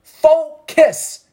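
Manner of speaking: high, ringing call to focus; high command. Acted. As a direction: snap them to attention. Repeating the command louder.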